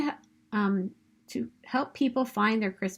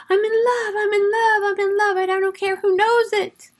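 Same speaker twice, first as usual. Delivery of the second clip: much higher, loud, lovestruck shout; giddy Buddy; 11 semitones higher, 6 dB louder than usual. Acted. Buddy interrupts his dad's meeting, giddy high shout. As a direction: burst in bursting with love, high and loud.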